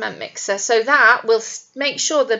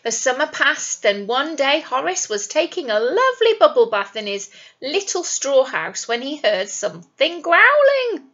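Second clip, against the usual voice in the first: usual speaking voice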